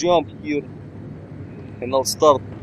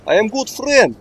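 alarmed protest; 7 semitones higher, 4 dB louder than usual. Real, not acted. Guards suddenly shooting at him.

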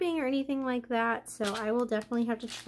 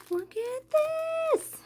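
much higher, slow squeal of delight; excited squeal; slower than usual. Real, not acted. Delighted unboxing reveal.

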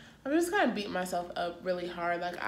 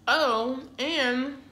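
ordinary talk, nothing marked

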